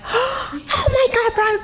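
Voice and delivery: very high, slow squeal, laughing and crying; ecstatic screaming. Retold or imitated. Telling the boss she's naked in the shower, screaming with joy. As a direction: scream with joy, half sobbing. Naked, learning she got the job.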